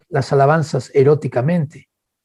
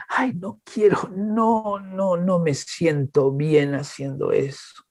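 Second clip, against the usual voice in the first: soft, slow, whining refusal; whiny reluctant believer; 7 dB quieter, much slower than usual. Retold or imitated. Believer whining back at God in a soft, slow voice. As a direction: drag it out like a sulking child. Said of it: "y tú dices".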